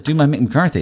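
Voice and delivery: high, loud incredulous query; retold disbelief. Comic is asked to perform for the manager, retold disbelief. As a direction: echo the request as a startled question. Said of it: "I was like".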